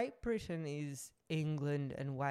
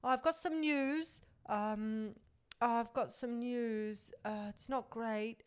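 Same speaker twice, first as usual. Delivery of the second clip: higher, slow shaky-edged quoting of a tearful contestant; mock tearful Zara, higher and slow; upset announcement; 7 semitones higher, slower than usual. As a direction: break the news as if about to cry. Quoting Zara breaking bad news.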